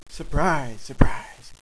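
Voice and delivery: forceful stage whisper; whispered surprise. Acted. Sneaky fighter springs a surprise, whispering. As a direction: hiss it out without voice.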